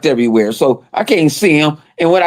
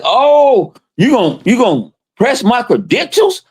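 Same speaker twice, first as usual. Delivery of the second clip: quoting a confrontation; 7 semitones higher, slower than usual. Retold or imitated. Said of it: "He was like".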